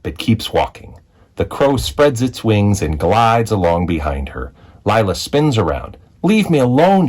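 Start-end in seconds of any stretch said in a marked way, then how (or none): 6.2-7.1 sharp, high, loud yell. A narrator's reading. Lila yelling at the crow to leave her be, loud. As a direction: push everyone away with it. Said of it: "she yells".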